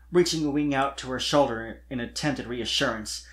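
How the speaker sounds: usual speaking voice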